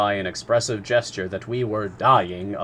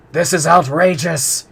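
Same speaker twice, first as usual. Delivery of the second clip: slow, loud, higher furious outburst; angry harpooner shout; 8 semitones higher, 10 dB louder than usual. A narrator's reading. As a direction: bellow it in outrage. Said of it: "Ned Land shouted".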